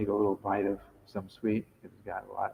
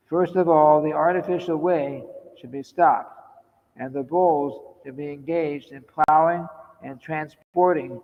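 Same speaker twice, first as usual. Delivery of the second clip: higher, louder declaimed reading; read-aloud quotation; 6 semitones higher, 10 dB louder, slower than usual. Acted. Speaker reading a teacher's words on farming, declaimed reading. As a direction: read out the instructions firmly, in measured phrases.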